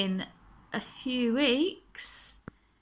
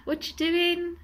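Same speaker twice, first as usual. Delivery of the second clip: imitating a parrot; 9 semitones higher, 4 dB louder, slower than usual. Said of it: "He says".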